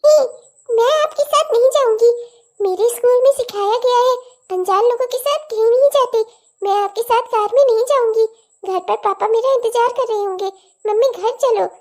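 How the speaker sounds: a small daughter puzzled by her mother; little girl. Acted. Daughter asks mother where they're going, little girl voice.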